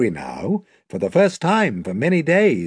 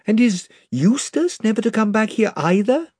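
put-on higher, worried girl's voice; Lucy asking; 9 semitones higher than usual. A narrator's reading. Lucy asks Aslan about Eustace, higher girl voice. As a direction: ask with hope and worry.

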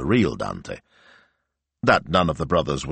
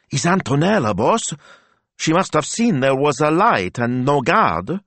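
timid sidekick voice; 10 semitones higher, 3 dB louder than usual. A narrator's reading. Narrator voices Smilzo.

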